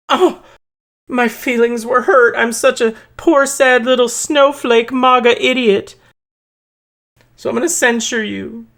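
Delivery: high, whiny mock snowflake voice; mocking whiny Republican. Mocking Republicans as whiny snowflakes, high whine. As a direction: sneer it like a crybaby. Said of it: "That's what Republicans do".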